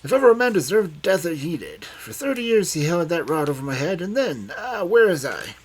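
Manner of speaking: put-on high, loud, excited old man; excited outburst. A narrator's reading. Old man raging to Loveday about Sandy, high and loud. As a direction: rail with feverish excitement. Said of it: "springing up excitedly".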